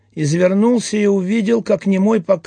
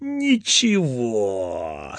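long, slow, low drawn-out word; relished drawl; 7 semitones lower, 4 dB quieter, much slower than usual. Acted. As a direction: savour it, stretching it slowly. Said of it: "смачно протянул он".

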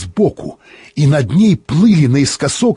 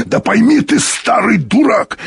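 exasperated shout; 8 semitones higher than usual. A narrator's reading. Heated quarrel over the order.

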